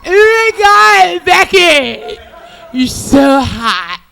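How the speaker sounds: put-on high, loud, slow gushing drunk girl voice. Drunk girl gushing to her friend Becky, high and drawn out. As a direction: gush at your friend like a tipsy girl. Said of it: "they be like".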